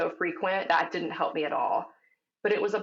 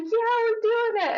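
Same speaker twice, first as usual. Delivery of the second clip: emotional cheer; 4 dB louder, slower than usual. Retold or imitated. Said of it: "And I'm getting emotional".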